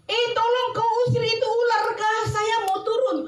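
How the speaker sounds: very high, acted cry for help; high, urgent mock wail; frightened child's plea. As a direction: plead in a panicky child's voice.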